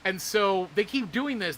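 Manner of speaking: ordinary delivery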